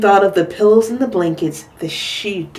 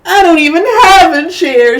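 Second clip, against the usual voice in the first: put-on loud, higher childlike shout; 8 semitones higher, 9 dB louder than usual. A narrator's reading. Little Chan shouting he has no chair. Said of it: "shouted little Chan".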